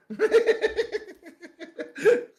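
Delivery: high, helpless giggling; real laughter. Real, not acted. Storyteller amused at not being his friend's type, high laughter. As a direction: crack up at your own punchline.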